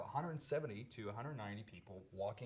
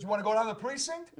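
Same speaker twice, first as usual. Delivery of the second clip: 1 fast mock cop yell; 10 semitones higher, 10 dB louder, faster than usual. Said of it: "He goes".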